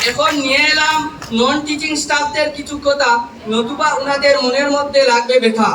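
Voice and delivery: loud, measured public recitation of verse; poem recitation. Acted. Trainee reciting a farewell poem to college teachers, declaimed loudly. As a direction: declaim rhymed tributes line by line, pausing between phrases.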